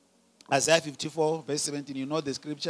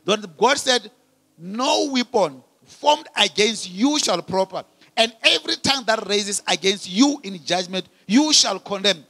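loud, declamatory sermon delivery, pitch often lifted; full-force preaching; 4 semitones higher, 8 dB louder than usual. Real, not acted. Preacher declaring righteousness in Christ Jesus to the congregation, full-force preaching.

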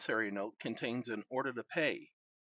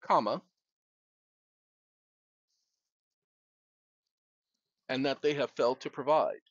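dictating; 2 semitones higher than usual. Real, not acted. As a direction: speak each phrase for voice typing. Dictating to a phone.

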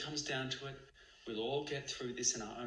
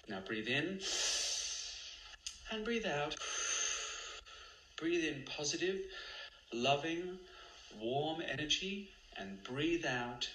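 guided meditation; 2 semitones higher than usual. Acted. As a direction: guide each breath calmly.

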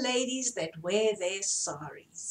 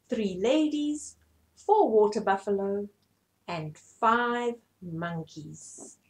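ordinary delivery

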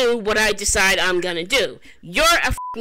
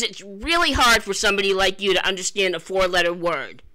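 normal talking voice

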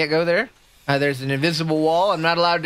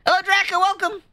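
very high, squeaky welcome; excited greeting; 13 semitones higher than usual. Real, not acted. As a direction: chirp hello way up high. Streamer greets arriving viewer.

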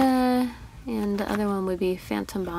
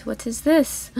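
ordinary talk, nothing marked

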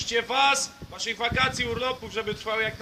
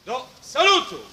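barked parade-ground command; drill command; 7 dB louder than usual. Acted. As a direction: shout it like a drill sergeant. Military commands to cadets.

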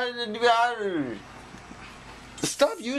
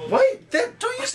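high alarmed cry; 4 dB louder than usual. Real, not acted. Comb scratching head.

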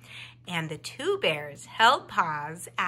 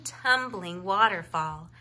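ordinary delivery, nothing marked

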